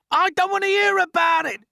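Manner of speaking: very high, loud; shrieked. A narrator's reading. Earthworm refusing to hear of cloud men, shrieking. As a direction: shriek in terror. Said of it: "shrieked the poor blind earthworm".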